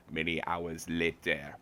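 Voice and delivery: slow, dramatic cartoon narrator; SpongeBob narrator voice. Shown as a demonstration. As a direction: announce it like a cartoon title card. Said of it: "imagine that bit in the style of SpongeBob".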